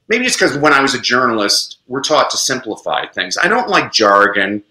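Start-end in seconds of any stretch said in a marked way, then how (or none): none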